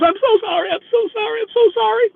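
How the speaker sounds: high-pitched, hammed-up tearful wail; mock crying. Crying passenger apologizing to her driver, mock sobbing. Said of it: "she's crying".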